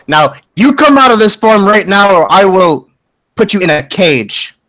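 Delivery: loud, stern motherly threat; mom voice. Acted. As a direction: lay down the law like a scolding mother. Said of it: "with the mom voice".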